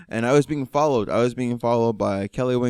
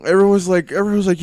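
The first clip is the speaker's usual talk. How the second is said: ordinary talk, nothing marked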